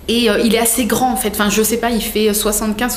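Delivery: usual speaking voice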